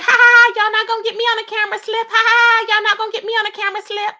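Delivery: hammed-up imitation of a smug woman laughing; mocking her laugh. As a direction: mimic her gloating laugh and brag. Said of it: "when she was laughing, saying".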